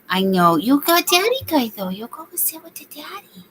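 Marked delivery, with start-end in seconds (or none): 0.6-1.9 dog talk. Real, not acted. Soothing the dog. 1.9-3.4 soft whisper to the dog. Real, not acted. Owner whispering to her dog to go sit with daddy.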